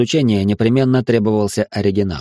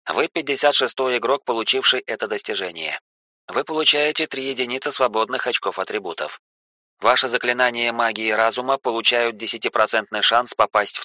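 softer, even notification read; system message voice; 5 dB quieter than usual. Acted. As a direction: read it like a game interface prompt.

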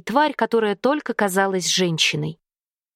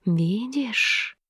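soft, sly, softer whisper; insinuating snake voice; 4 dB quieter than usual. A narrator's reading. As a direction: slip the word in softly and slyly. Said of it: "Вкрадчивый голос в её голове".

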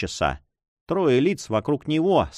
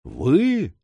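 rising, incredulous exclamation; astonished cry; 3 semitones higher than usual. A narrator's reading. Young man learning who the lady is, astonished cry. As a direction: raise the pitch in startled disbelief. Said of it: "вскричал он".

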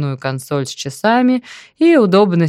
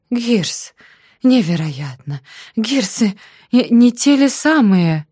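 excited cry, much higher; delighted exclamation; 5 semitones higher, slower than usual. A narrator's reading. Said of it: "с восторгом воскликнул". (восторженный возглас)

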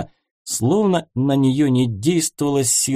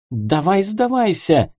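higher, bossy princess cry; exclaiming princess; 8 semitones higher than usual. A narrator's reading. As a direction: demand surrender, hands on hips. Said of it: "воскликнула принцесса".

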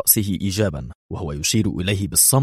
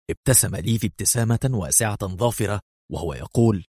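ordinary delivery

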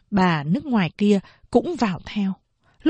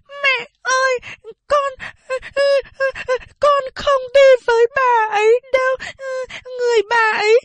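put-on much higher, wailing little girl's cry; a small girl sobbing and refusing to go; sobbing child; 4 dB louder than usual. Little girl begs her mother not to send her away, sobbing. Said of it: "Chị Bảy sụt sịt khóc".

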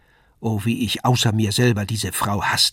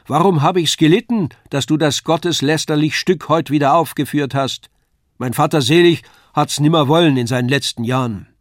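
loud, high-pitched reproach; quarrelling wife; 5 semitones higher, 7 dB louder than usual. A narrator's reading. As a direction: berate your husband across the hall. Wife scolds husband in quarrel.

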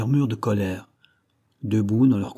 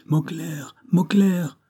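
urgent cry; 8 semitones higher, much slower than usual. A narrator's reading. Wounded captain calling out amid the attack, high cry. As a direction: cry out for someone, voice pitched well up. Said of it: "cria le capitaine Hod".

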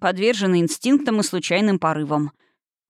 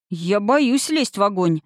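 ordinary delivery, nothing marked

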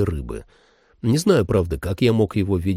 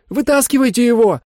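much higher, louder woman's bark; 14 semitones higher, 5 dB louder than usual. Acted. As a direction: bark at them like a furious woman. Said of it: "рявкнула на них Холли".

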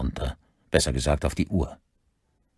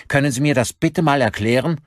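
high-pitched angry question; indignant, raised-voice challenge; furious demand; 10 semitones higher, 5 dB louder than usual. A narrator's reading. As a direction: demand an explanation, voice rising in anger. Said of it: "Wutentbrannt schaute Stuart Campbell".

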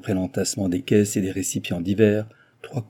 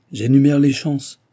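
cold reply; 5 semitones higher, 6 dB louder than usual. A narrator's reading. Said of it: "répliqua froidement mister Big".

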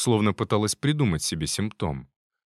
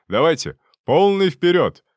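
louder, higher command; loud send-off; 8 semitones higher, 8 dB louder than usual. Acted. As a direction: shout him out the door. Mentor shoos him off.

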